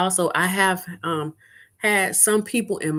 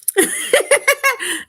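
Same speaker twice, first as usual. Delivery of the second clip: a squealing, high-pitched laugh; high giggle; 6 dB louder than usual. Real, not acted. Laughing at the man's bold answer, squealing giggle. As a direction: burst out giggling, higher and louder.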